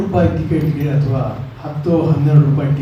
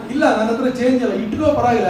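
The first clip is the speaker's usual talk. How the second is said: lightly acted, higher-pitched exchange; carefree exchange; 7 semitones higher than usual. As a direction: wave it off in a high, easy voice. Acting conductor and elated lottery winner.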